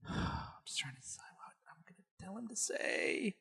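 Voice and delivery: barely voiced, very soft whisper; sighing, private whispered musing; thinking to oneself. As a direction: whisper it under your breath while deliberating. Deliberating under the breath to self.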